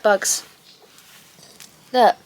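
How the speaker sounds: normal talking voice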